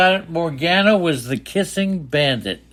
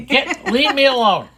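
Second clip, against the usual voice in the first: lightly voiced cranky old man, high; high-pitched old man voice; 9 semitones higher than usual. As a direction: whine it like a fussy old man. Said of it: "your high-pitched elderly man voice".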